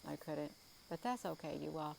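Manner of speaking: ordinary talk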